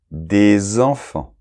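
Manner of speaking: des enfants said very slowly as dé-z-enfants; liaison modelled. Shown as a demonstration. Teacher slowly models the liaison in des enfants. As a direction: stretch des enfants and voice the z link. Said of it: "Et là, on a la liaison".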